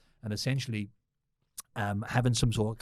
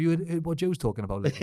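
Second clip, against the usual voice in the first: imitating Ian; 6 semitones higher than usual. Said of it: "He said".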